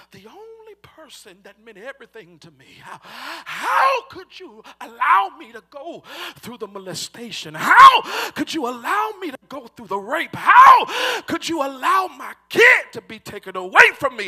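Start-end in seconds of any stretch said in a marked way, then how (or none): 2.8-14.3 loud, climbing preacher's cry of anguish; anguished preaching shout building to a peak; shouted lament. Acted. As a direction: hurl each how at heaven, louder each time.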